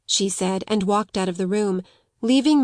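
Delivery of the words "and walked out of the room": ordinary delivery, nothing marked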